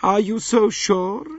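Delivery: put-on high, slow haughty old lady; lofty aged princess voice. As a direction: voice the elderly princess, cool and proud.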